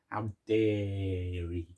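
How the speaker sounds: slow, drawn-out How dare he in a Jay Blades voice; Jay Blades impression. Telling the milkman joke in a Jay Blades voice. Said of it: "Jay Blaze, yeah?".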